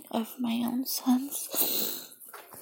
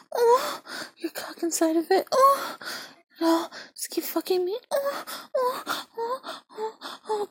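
much higher, crying-out moans; climbing cries of pleasure; high moaning cries; 10 semitones higher than usual. Acted. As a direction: let the voice jump high and break. Peak moans in roleplay.

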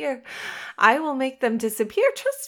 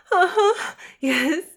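usual speaking voice

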